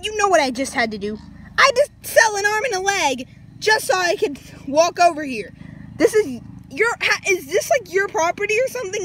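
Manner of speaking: mock-outraged rant; indignant complaint. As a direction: confront them over being cheated. Acted indignant quarrel with Alex.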